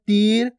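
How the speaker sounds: long drawn ee in तीर; stretched long ee. Shown as a demonstration. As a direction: hold the ee of तीर before the r. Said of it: "थोड़ा खींच के उच्चारण कर रहे हैं". (तीर में ई खींचकर)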